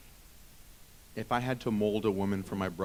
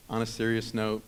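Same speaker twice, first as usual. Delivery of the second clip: ordinary talk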